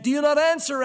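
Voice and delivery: loud, high call in the dark; shouting across the night. Retold or imitated. David calling to Abner across the valley, loud shout. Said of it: "this voice shouting in the night".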